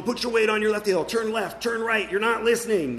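high, loud frustrated yelling down the slope; exasperated dad shouting ski tips; yelled instructions. Retold or imitated. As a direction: bark orders at a kid who won't listen.

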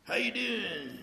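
Texas drawl greeting. Retold or imitated. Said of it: "Wolfman Jack with a Texas accent".

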